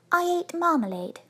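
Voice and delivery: high small bear with mild pride; rather proud. A narrator's reading. Bear telling the couple what was eaten, rather proud. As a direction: announce it proudly in a little voice. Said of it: "he said rather proudly".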